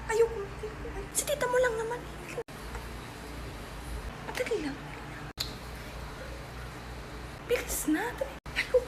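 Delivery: very soft, urgent undertone; hushed bickering. Acted. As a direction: argue so the caller cannot hear. Hushed argument before aunt's phone call.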